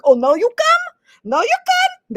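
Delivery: shrieking high mock outrage; hammed-up Israeli indignation, loud and very high; indignant Israeli. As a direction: scold them sarcastically for showing up late. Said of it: "don't be like".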